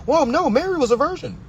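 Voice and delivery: high-pitched mock believer. Man imitating people who defend the virgin birth, high mocking protest. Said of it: "You really got people out here saying shit like that".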